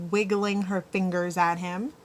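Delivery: ordinary delivery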